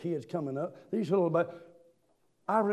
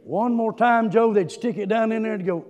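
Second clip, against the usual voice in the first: ordinary talk, nothing marked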